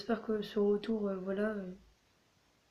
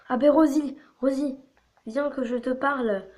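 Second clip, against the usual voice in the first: higher, louder call across the café; 4 semitones higher, 6 dB louder than usual. Acted. She calls Rosie over in the café, a loud cheerful call. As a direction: hail Rosie from across the room.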